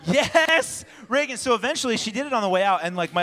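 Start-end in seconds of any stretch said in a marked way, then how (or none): 0.0-1.4 high, loud drawn-out yes; mock exasperated outburst at your kid; comically fed-up father; exasperated dad. Exasperated dad, asked yet again.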